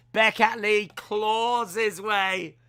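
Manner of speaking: hammed-up slow intro; announcer hype. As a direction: boom it like the ring announcer.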